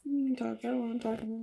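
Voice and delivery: high, soft, choked-up voice; too emotional to go on; teary. Real, not acted. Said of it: "trying not to cry".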